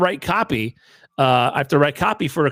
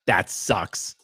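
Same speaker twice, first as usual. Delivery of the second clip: lower, softer, deadpan doctor imitation; mocking the doctor; 6 semitones lower, 5 dB quieter than usual. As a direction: drop it flat like an unbothered doctor. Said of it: "What is that, a medical opinion?".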